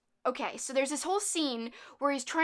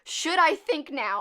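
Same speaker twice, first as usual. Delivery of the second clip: slow, louder mock question; 4 semitones higher, 7 dB louder, slower than usual. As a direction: drag it out for effect. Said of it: "I'm gonna keep repeating it because it's absurd".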